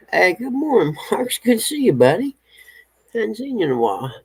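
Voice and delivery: high, bright-eyed hello to a pet; sing-song dog greeting. Real, not acted. Owner greeting the dog Marks in the yard, high sing-song pet talk.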